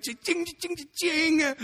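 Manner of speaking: high-pitched sing-out of cha-ching; imitated cash register. Patient hearing the costs add up, high mock cash-register ring.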